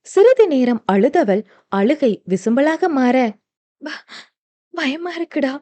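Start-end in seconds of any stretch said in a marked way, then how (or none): 3.8-5.5 high, soft broken fear; stammering through tears. Acted. Mithra stammering her fear to Aarav, high and soft. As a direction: stumble over the first word, scared and teary. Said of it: "திக்கி திணறி கூறினாள்".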